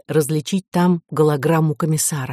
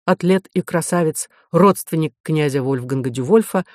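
ordinary delivery, nothing marked

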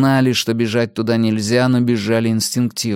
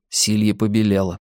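ordinary delivery

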